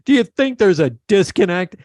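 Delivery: high, challenging New York voice; New York accent. Retold or imitated. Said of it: "He goes".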